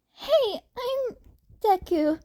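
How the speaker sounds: put-on high, bright teen-boy voice; chirpy hello to a stranger; cheerful Izuku. A narrator's reading. Izuku greets the sulking man, cheerful and high. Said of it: "Izuku said cheerfully".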